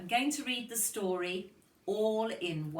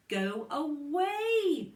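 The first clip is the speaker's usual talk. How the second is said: slow, loud, drawn-out go away; gruff dad; 9 semitones higher, 6 dB louder, much slower than usual. Acted. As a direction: shoo the child off firmly. Father shooing son while shaving.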